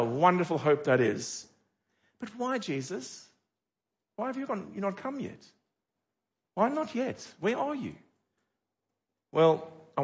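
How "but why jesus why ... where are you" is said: high, soft longing cry to Jesus; hushed, yearning lament; plaintive plea. Pretend. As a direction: ask it like an aching prayer. Voicing believers' plea to Jesus.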